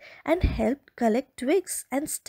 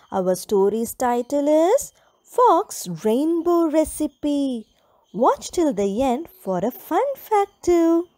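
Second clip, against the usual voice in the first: put-on lively storybook voice for little kids; animated bedtime-story read; reading to children; 5 dB louder than usual. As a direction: tell it brightly to small children.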